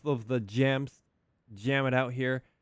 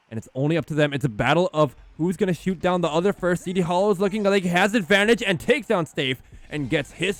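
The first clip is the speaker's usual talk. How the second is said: excited; 5 semitones higher, 6 dB louder than usual. Real, not acted. Caster calls a tense duel.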